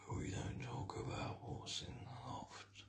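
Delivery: hushed, barely voiced aside; secretive whisper.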